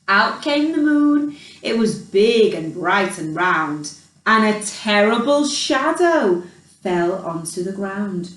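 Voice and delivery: ordinary delivery, nothing marked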